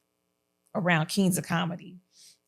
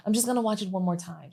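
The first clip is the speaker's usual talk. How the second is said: quick, softer imitation of a child; imitated daughter; 6 dB quieter, much faster than usual. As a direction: slip in a quick, quiet child's request. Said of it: "She's real proud".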